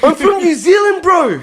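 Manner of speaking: very high, comic indignant wail; laughing, high-pitched mock protest; mock offended Kiwi. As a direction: insist on it in hammed-up outrage. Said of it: "if you are a Kiwi".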